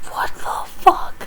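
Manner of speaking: slow whispered disbelief; stunned whisper. Real, not acted. Fan stunned by the result, whispering.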